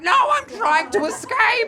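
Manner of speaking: very high, loud shriek; hammed-up hysterical confession; desperate outburst. Sleepless father answering gate attendant, desperate high screech.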